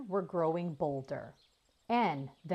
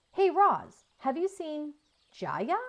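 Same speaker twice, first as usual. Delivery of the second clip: put-on louder, higher eager call of a panting boy; breathless boy calling; 10 semitones higher, 6 dB louder than usual. A narrator's reading. Boy bursting in out of breath, calling for his sister.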